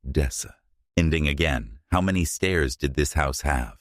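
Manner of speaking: ordinary delivery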